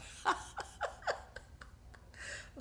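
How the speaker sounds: breathy, high-pitched burst of laughter; real high giggle; high squeaky laugh. Real, not acted. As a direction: let a giggle escape high and airy.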